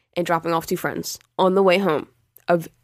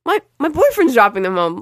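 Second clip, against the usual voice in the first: indignant; 6 semitones higher, 5 dB louder than usual. Real, not acted. Indignant protest to mom.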